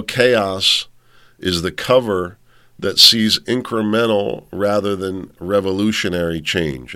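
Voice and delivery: normal talking voice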